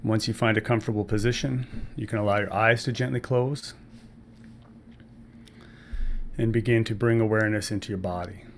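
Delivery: calm breathwork guide with long pauses between phrases; measured meditation-teacher voice, phrase by phrase; guided meditation. Acted. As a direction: ease listeners into the breath, unhurried and even.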